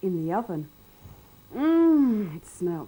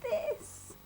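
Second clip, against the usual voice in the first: slow, high hammed-up whimper; mock crying; 11 semitones higher, 7 dB louder, much slower than usual. A narrator's reading. Mister Bear kept awake again, mock sobbing. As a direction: wail it like a sob. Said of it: "said Mister Bear".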